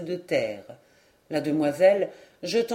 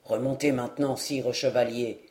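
much lower, measured dwarf voice; a small man checking a rival, low and steady; the dwarf warning; 8 semitones lower, 4 dB quieter than usual. Acted. Dwarf to the eager knight, low calm warning.

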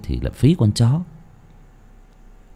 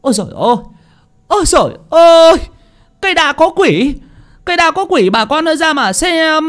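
shouting in panic. Acted. Villager running to the village shouting about the banyan ghost, panicked yell. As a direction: cry out to the whole village in terror. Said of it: "hô to".

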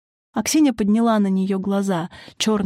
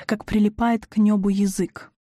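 usual speaking voice

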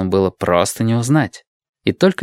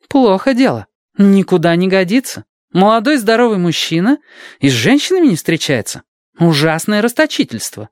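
mock scolding nurse; 10 semitones higher than usual. Tamura scolding Tengo.

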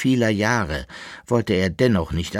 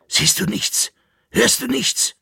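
high, urgent questions; alarmed; 4 dB louder than usual. A narrator's reading. Jäckele-Narr halts his companion in the dark, alarmed and urgent. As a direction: press him in rising alarm.

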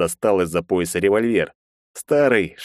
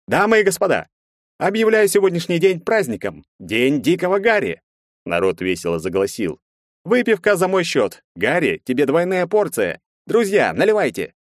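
shouting; 10 semitones higher than usual. A narrator's reading. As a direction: shout it to the whole room. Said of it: "закричал".

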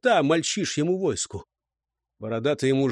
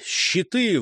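ordinary delivery, nothing marked

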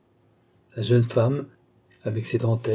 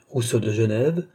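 ordinary delivery, nothing marked

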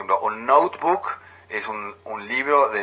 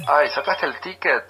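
higher, affected "Ay" leading into "ticket"; mock trendy speaker; 5 semitones higher, 3 dB louder than usual. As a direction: tease people who say "ticket" instead of "pasaje". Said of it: "otra cosa que dice".

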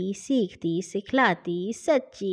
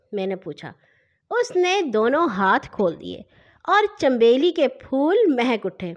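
ordinary delivery, nothing marked

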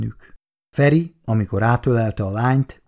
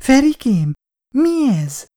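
very high, louder frightened cry; horrified; 14 semitones higher, 3 dB louder, slower than usual. A narrator's reading. Girl to her Feri as three thugs threaten, horrified. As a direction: voice leaping up in alarm. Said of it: "rémüldözött Icu".

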